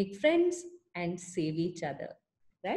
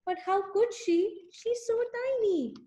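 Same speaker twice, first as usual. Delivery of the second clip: much higher, tender pity; 10 semitones higher than usual. Acted. Telling the child how tiny the snail is, high pitying voice. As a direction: squeak it out, so small.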